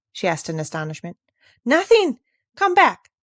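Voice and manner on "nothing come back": high, loud panicked pleading; frightened boy calling her back; stammering with fear. A narrator's reading. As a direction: blurt it out in a fright. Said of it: "he said, stammering with fear".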